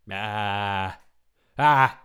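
fake sob. Shown as a demonstration. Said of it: "I wanna cry".

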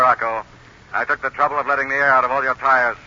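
ordinary talk, nothing marked

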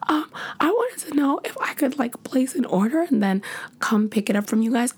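very high breathy flustered voice; shy squeaky request on the phone; flustered high whisper. Retold or imitated. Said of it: "I'm flustered".

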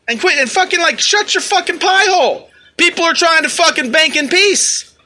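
high-pitched, worked-up telling-off; exasperated rant. Acted. Rant telling protester to shut up.

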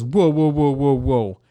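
loud, high run of objections; mock alarmed protest. Customer seeing the quote drop, loud imitated protest. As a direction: imitate a customer cutting in to halt the deal. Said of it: "And he goes".

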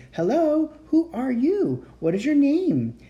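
put-on high, eager child voices; children eagerly asking strangers questions; chattering children. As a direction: voice excited little kids.

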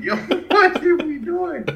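high, slow exasperated plea; half-dead complaint, hammed up; complaining to Rick while washing the car, high and slow; mock exasperation. Acted exasperation.

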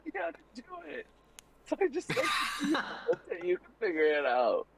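high squeaky laughing voice; laughing falsetto. Retold or imitated. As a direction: giggle it out up high.

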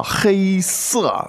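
黑色 with hēi held high and level; 黑色 sung on a steady high first tone. Shown as a demonstration. Teacher modelling 黑色 for learners, high level tone. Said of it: "first tone".

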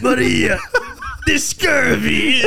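loud hammed-up pirate, slow and shouting; a pirate assistant yelling about scurvy. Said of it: "her assistant, but a pirate".

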